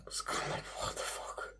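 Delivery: breathless whispered disbelief; whispered aside. Real, not acted. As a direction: whisper it under your breath.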